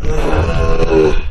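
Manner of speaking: low, drawn-out groan; fed-up groan, dropped low; exasperated groan. Real, not acted. Fed up with the camera.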